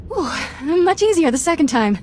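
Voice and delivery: relieved exhale. Acted. Relieved after confessing to council.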